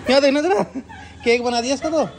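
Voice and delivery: high, excited exclaiming. Real, not acted. Excited about smashed pots.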